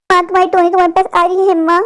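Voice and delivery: normal talking voice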